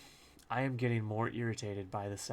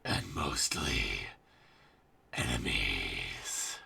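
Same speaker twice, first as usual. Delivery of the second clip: sinister whisper; slower than usual. Acted. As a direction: drop to a low whisper for enemies. Sinister aside after greeting.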